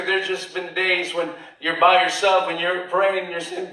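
ordinary delivery

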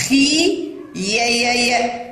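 slow خي, then repeated "ya" on يا; yaa pronounced. Shown as a demonstration. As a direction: sound out خي يا syllable by syllable. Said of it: "الياء في كلمة خيار تنطق". (تهجئة خي ثم تكرار يا)

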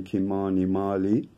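ordinary talk, nothing marked

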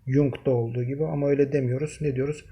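ordinary talk, nothing marked

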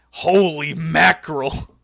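slow, loud mock exclamation; Vince McMahon. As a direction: draw it out in a booming, hammed-up boss voice. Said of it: "and he goes".

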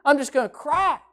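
frazzled complaining voice; mock exasperation. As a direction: play someone at wit's end. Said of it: "Sometimes people say".

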